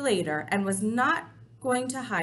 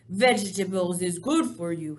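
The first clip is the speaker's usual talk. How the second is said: persuasive BFG; 2 semitones lower, 6 dB louder than usual. Acted.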